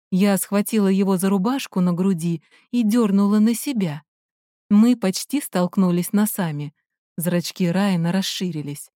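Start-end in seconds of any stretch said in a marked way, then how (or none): none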